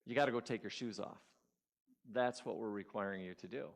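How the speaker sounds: low, very soft instruction; calm inner voice. Acted. Inner voice instructing the speaker to go barefoot, calm. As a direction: speak quietly, like a calm voice within. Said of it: "in the calmness of all of it".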